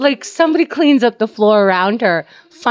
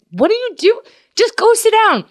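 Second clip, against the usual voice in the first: mock scolding nurse; 12 semitones higher, 3 dB louder than usual. Nurses shooing the flirting patient.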